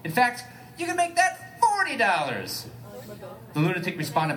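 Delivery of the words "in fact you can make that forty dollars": high, slow pleading bargain; much higher and slower, wheedling the driver; put-on Elliot voice.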